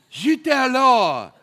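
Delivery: mock dismay.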